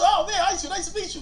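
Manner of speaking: put-on high, eager child's voice; excited kid. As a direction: gush like a starstruck ten-year-old. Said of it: "I was a little dude".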